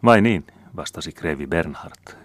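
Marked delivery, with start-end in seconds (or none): 0.0-0.5 "Vai niin?" higher and louder, falling; a detached, noncommittal "Vai niin?"; "Vai niin?" as a calm, icy brush-off; cool "vai niin". A narrator's reading. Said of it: "käyttäen tuota mainiota "vai niin".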